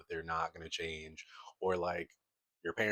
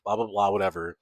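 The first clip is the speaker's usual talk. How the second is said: mocking laugh; 2 semitones higher, 7 dB louder, faster than usual. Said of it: "they thought".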